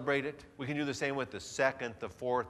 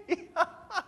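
amused laugh. Acted. Recognizing himself on tape.

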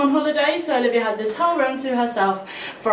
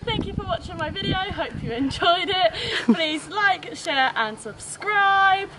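high cheery YouTuber sign-off; vlog outro; 6 semitones higher, 5 dB quieter than usual. Acted. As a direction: sell the outro like a vlogger.

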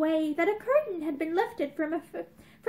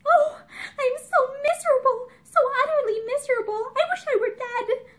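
high, sobbing wail of misery; 6 semitones higher, 3 dB louder than usual. Acted. Girl breaking down in tears to Mickey, sobbing. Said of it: "broke down again into bitter sobbing".

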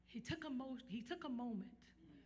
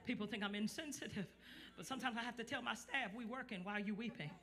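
loud, rhythmic sermon, pushing hard to the crowd; hammering the congregation with call and response; fiery preaching; 6 semitones higher, 18 dB louder than usual. Acted. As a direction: preach it at full force to the room. Preaching to congregation, full force.

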